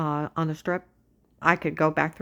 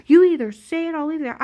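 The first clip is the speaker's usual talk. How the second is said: high, loud, fast mock threat; hammed-up agent ultimatum; imitating angry agent; 9 semitones higher, 9 dB louder, faster than usual. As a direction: snap it like a bullying agent. Agent threatening in retold quarrel.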